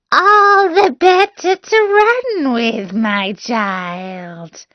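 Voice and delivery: the wolf straining to sound like grandma; hammed-up high granny voice, slow; wolf as grandmother.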